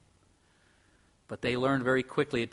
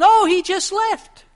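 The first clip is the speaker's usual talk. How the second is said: very high, loud, slow sing-song fib; sweet, overdone phone fib; father lying; 14 dB louder, slower than usual. Retold or imitated. Barman father covering for a patron, high and loud.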